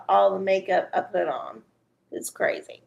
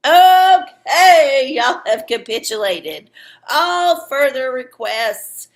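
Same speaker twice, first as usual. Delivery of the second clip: loud, high, long-drawn "okay"; big hammed-up call that time is up; announcer proclamation; 3 semitones higher, 9 dB louder, slower than usual. Host closing questions after the countdown, loud proclamation.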